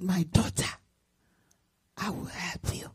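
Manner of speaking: low, hushed, breathy answer from above; voice of God. Retold or imitated. God comforting his worried daughter, low and hushed. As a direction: let God's words come soft and distant.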